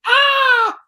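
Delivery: sudden high loud shriek; surprised shriek. Real, not acted. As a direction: yelp out in shock. Reacting to the mock threat.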